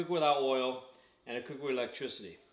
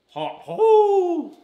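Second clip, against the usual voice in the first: hot food yelp; 15 dB louder than usual. Real, not acted. Bites into very hot chicken.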